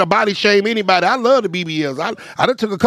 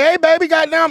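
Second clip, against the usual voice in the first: a higher, impressed catcall; mock admiring catcall; 7 semitones higher than usual. Man eyeing a surgically shaped woman, higher mock catcall. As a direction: coo at her like a smitten man.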